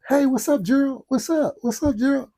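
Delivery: put-on high-pitched child's greeting; imitated little boy. Little boy greeting Gerald on the porch, high imitated kid voice. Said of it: "So he comes out on the porch".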